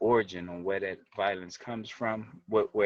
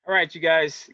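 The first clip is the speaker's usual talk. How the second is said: raised, higher voice calling the group to order; loud and higher, grabbing everyone's attention; loud call to start; 8 semitones higher, 10 dB louder than usual. Real, not acted. Host kicks off the livestream for viewers, loud and higher. Host opens the live session.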